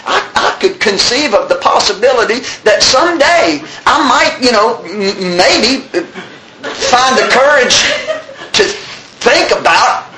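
high, halting comic reluctance; hesitant hedging. Acted. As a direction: inch toward the promise with long pauses. Comic hedged promise to quit.

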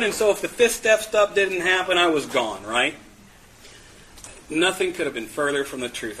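ordinary talk, nothing marked

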